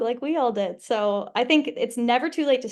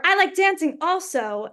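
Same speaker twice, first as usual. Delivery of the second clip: imitating the French director, higher; French accent; 6 semitones higher than usual. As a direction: quote his line in a French accent. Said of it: "in his French accent".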